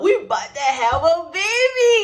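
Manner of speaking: high, loud excited outburst; re-enacted joyful yell. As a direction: blurt out the big news, rising. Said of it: "I was so excited".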